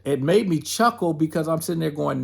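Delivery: normal talking voice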